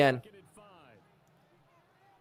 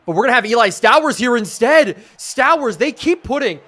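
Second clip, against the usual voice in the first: raised, louder commentary; excited play call; 5 semitones higher, 7 dB louder than usual. Real, not acted. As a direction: call the receiver with rising urgency. Live call as the play develops.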